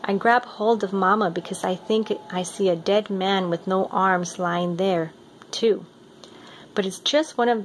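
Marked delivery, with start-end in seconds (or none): none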